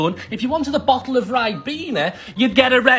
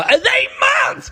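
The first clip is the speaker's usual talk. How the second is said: high, drawn-out disbelief; incredulous outcry; slower than usual. Pretend. Commentator appalled at a cheap sub controller, incredulous outcry. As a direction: shriek the question, stretching it out.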